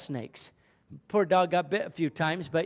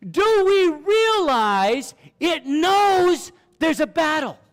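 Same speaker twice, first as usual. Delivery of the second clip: higher, louder preaching, slowed and drawn out; preaching at full force; 10 semitones higher, 6 dB louder, slower than usual. Acted. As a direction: lift the congregation with each phrase. Preaching climax.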